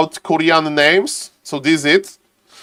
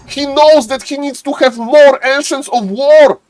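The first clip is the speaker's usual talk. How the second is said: excited play-by-play; 7 semitones higher than usual. Acted. Caster hyping viewers over a Warcraft match, excited play-by-play. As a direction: call the action like a thrilled caster.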